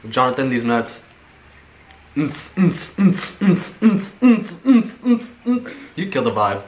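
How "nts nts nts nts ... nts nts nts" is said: beatbox. Pretend. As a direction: mouth a club beat, getting bigger. Beatboxing a club beat.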